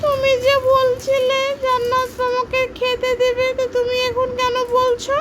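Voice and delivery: squeaky complaining doll; high doll voice. Pretend. Toy friend complains. (উঁচু পুতুলের গলা)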